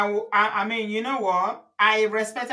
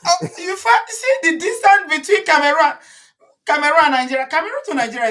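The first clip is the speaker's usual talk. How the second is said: excited; 8 semitones higher, 4 dB louder than usual. Real, not acted. Laughing, excited protest.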